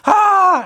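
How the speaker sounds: sudden high shriek of glee; maniacal shriek. Retold or imitated. Daughter running off after a prank, a high shriek. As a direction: shriek like a cackling kid fleeing.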